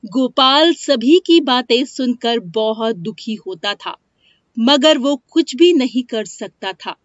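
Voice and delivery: ordinary delivery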